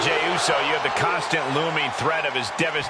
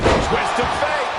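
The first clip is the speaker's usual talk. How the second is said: slow high hyped call; excited call; 9 semitones higher, 4 dB louder, slower than usual. Acted. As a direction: drag the move name out, hyped. Live match commentary call.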